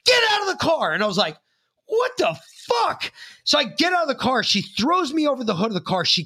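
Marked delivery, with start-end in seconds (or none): 0.1-0.9 high shouted order; mock command. Trooper ordering him out of the car, shouting high. 1.8-3.1 high, slow, drawn-out disbelief; stunned disbelief. Retold or imitated. Teen stunned as trooper orders him out, slow and high. His stunned reaction retold.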